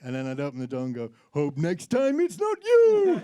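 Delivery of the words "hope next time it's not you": high, loud, drawn-out comic Death voice; Stupid Death character. Retold or imitated. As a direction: sing out a ghoulish doorstep greeting, high and slow. Acting Stupid Death at the door.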